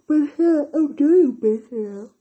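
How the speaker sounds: loud, slow, insistent; loud and emphatic. Real, not acted. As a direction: really push it out. Reassuring herself emphatically.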